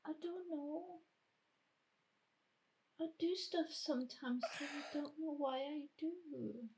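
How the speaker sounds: small, high, tearful voice; soft high-pitched confession close to crying; Trixie. Acted. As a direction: answer meekly, on the edge of tears. Trixie answering an accusation.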